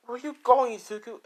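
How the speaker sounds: high-pitched call, loud then dropping; acted anxious girlfriend. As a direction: call after him in a girlish high voice. Rose calls after departing Izuku.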